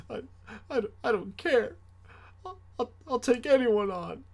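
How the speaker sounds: put-on high, far kid's defiant bluster; tearful tough talk. A scared kid in brother's arms, high bluster. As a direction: bluster bravely through tears. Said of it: "last resort trash".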